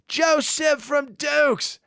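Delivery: high, drawn-out call of recognition; imitated delighted holler, slow and high. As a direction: hail an old pal across a bar. Star recognises him, calls out.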